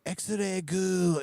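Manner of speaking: put-on over-the-top accent on X-ray goo; thick exaggerated local accent; overdone thick local accent. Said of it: "in your, uh, over-the-top accent".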